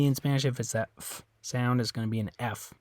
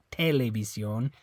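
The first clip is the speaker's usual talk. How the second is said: TELEVISIÓN wrongly as TEH-le-vi-syon; TELEVISIÓN with stress moved to the front; stress on first syllable. Shown as a demonstration. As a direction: hit the TE of TELEVISIÓN. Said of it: "it's not this E".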